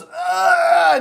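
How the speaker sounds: high, loud mock straining grunt; old man's grunt. Imitating the old man straining on the toilet, high loud grunt. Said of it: "he fucking goes".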